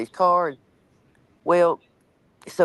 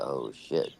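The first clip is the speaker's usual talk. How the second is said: hushed dismay; 13 semitones lower, 10 dB quieter than usual. Retold or imitated. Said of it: "I said".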